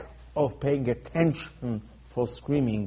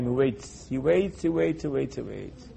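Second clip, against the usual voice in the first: patient waiting; 2 semitones lower, 8 dB quieter than usual. Shown as a demonstration. Repeats phrase to show long patience.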